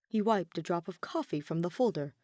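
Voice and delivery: ordinary delivery, nothing marked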